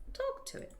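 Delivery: hushed breathy instruction; whisper. Real, not acted. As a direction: whisper it to the narrator.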